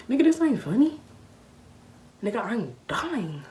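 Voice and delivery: high, loud mock outrage; hammed-up frantic complaint; indignant retort. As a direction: protest loudly, replaying the panic. Retold panicked retort to laughing cousin.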